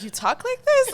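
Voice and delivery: high laughing mock shock; high, laughing shocked parents; laughing shocked quote. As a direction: laugh through the parents' disbelief.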